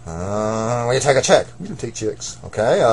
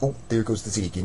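ordinary delivery

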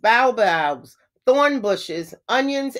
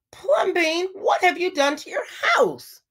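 much higher, aghast cry of outrage; mock outraged shout; 9 semitones higher than usual. A narrator's reading. Neighbors shouting at Plumbean over his house, aghast. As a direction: wail it like scandalized neighbors. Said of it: "the people shouted".

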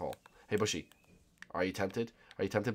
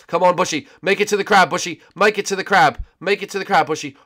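raised, higher, louder urging; urgent calling; 9 semitones higher, 8 dB louder than usual. Real, not acted. Player urging Bushy to make it to the crab, urgent calling. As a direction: call a straggling teammate over loudly.

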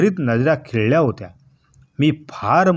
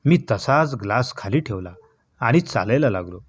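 ordinary delivery

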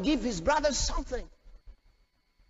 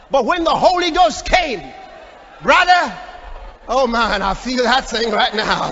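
loud, full-force pulpit delivery; impassioned preaching; 6 dB louder than usual. Real, not acted. Preacher feeling the anointing before the congregation, impassioned shout. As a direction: belt it out, swept up in praise.

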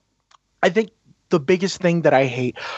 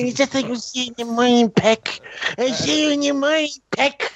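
high, slurred mock goodnight; drunken slurring; 8 semitones higher than usual. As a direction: imitate a drunk who can barely get words out. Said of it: "slurs and shit".

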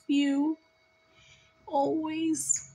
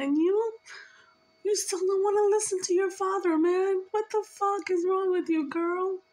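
higher-pitched, exasperated telling-off; exasperated scolding; 6 semitones higher than usual. Real, not acted. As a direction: scold her, pitch pushed up. Scolding the listener for ignoring father.

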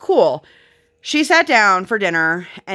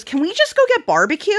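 put-on high-pitched little kid voice, a bit louder; imitating toddler; 13 semitones higher, 3 dB louder than usual. Mother voicing her daughter begging for barbecue, high and bright childlike. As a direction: pipe it up like an eager small child. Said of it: "she goes".

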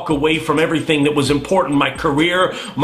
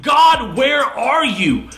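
high, raised anguished cry to heaven; crying out to God; 5 semitones higher than usual. Retold or imitated. Broken man crying out to God, shouting. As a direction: plead and shout at God, desperate. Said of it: "I cry and I call out".

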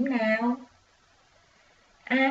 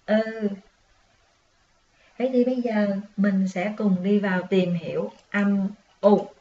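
ordinary talk

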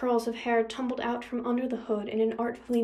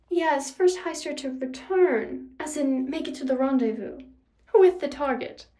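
mock-patient villainess; taunting villainess; 4 semitones higher, 4 dB louder than usual. A narrator's reading. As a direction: spell it out to taunt your rival. Nemesis mockingly corrects the rule.

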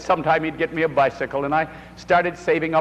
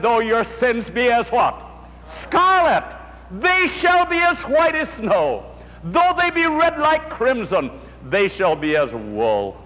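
higher, ringing scripture cry; rising scripture proclamation; 6 semitones higher than usual. Acted. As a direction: proclaim the promise, lifting each line. Preacher quotes scripture at full force.

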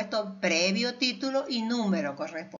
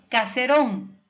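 caserón with a louder, stressed RÓN; stress on last syllable; 6 dB louder, slower than usual. Shown as a demonstration. Teacher showing students an aguda word, caserón stressed on RÓN. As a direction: hit the final RÓN of caserón. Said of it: "última sílaba".

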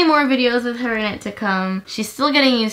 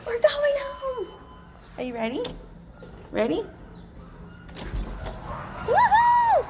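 excited pet talk; 6 dB quieter than usual. Real, not acted. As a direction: gush over the dog in a high squeal.

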